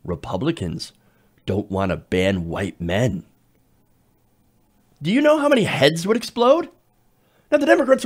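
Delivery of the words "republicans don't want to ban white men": deep, slow, soft attack-ad voiceover; mock ad voiceover. Imagining a Democrat ad before the midterms, in a low slow voiceover. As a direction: read it like a grim campaign ad tagline. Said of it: "with the tagline".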